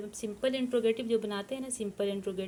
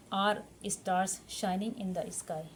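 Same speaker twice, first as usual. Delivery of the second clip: slow dictation; much slower than usual. Shown as a demonstration. Teacher dictating English question.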